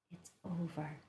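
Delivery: very soft, hushed words; whisper. A narrator's reading. Jessie in the dark after the fireworks, whispering. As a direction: breathe it out barely audible. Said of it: "Jessie whispered".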